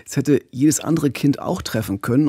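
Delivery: ordinary talk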